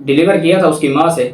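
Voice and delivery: ordinary delivery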